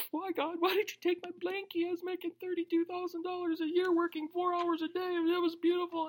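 put-on high, soft, whiny voice like a sulking child; mock whiny complaint. Preacher mocks his younger self whining to God after being fired. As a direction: whine to God about your lost blankie. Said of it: "got me fired".

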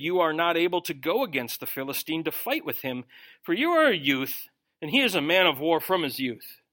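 ordinary talk, nothing marked

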